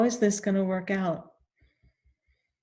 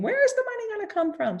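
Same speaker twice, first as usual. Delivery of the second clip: much higher, fast mock scream; mother screaming; faster than usual. Mother panicking about money, imitated in a high fast mock scream. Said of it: "it was my mother screaming".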